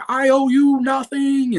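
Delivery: high, slow, put-on female voice; defiant movie quote. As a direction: throw the line back at him, slowly and high. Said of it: "and she's like".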